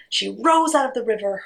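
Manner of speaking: plain reading voice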